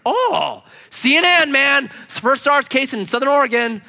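high, loud, excited hospital staff; hammed-up astonishment, pitch way up; mock alarm. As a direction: blurt it like breaking news.

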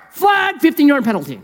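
sharp high shout of a referee throwing a flag; mock referee call. Scribes as referees flagging Jesus, mock shouted penalty call. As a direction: bark it out like a ref calling a foul.

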